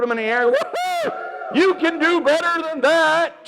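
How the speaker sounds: very high, loud whoop into a rallying push; hype cheer. Real, not acted. Presenter pumping up the twos in the audience, loud excited cheer. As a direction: fire the crowd up.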